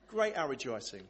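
soft, unenthusiastic rejoicing; underwhelmed. Shown as a demonstration. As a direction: say it as if barely bothered. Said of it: "that underwhelming response".

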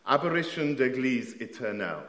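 French title with a French accent. Shown as a demonstration. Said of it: "excuse my French accent".

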